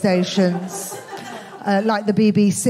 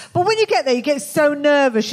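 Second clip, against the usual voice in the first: ordinary delivery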